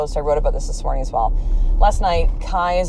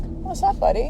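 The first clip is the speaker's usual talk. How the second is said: light, high, tender question to a little one; gentle parent; 13 semitones higher than usual. Retold or imitated. Parent gently checks on upset child.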